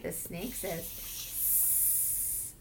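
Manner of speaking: whispered snake hint. Acted. Slow breathy snake prompt.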